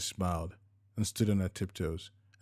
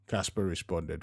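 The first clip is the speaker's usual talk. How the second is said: ordinary delivery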